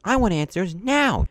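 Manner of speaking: loud, high angry instructor; angry demand. Retold or imitated. Instructor interrogating the students, angry high shout.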